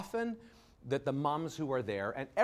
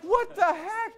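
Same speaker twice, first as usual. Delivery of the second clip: high, startled exclamation; baffled; 3 dB louder, slower than usual. Retold or imitated. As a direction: throw it out in disbelief. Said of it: "I'm like".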